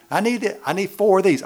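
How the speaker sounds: high, loud, eager mock woman rummaging. Preacher mimicking a church lady with a full purse, high and eager. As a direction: ham up a woman offering everything from her purse.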